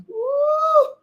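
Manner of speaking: high shouted woo; ecstatic whoop. Real, not acted. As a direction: let out a joyful preacher's whoop.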